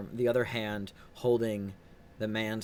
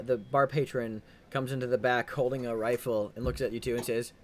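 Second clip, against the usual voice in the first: ordinary talk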